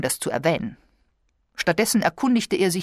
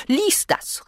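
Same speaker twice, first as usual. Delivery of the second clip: much higher urgent call; sharp cry; 12 semitones higher than usual. A narrator's reading. Invalid wife crying out to her husband, much higher urgent call. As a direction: thrust the letter at him with a cry. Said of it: "rief sie".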